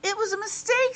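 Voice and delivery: put-on high, pleading young boy; a small boy pleading his case; boy character voice.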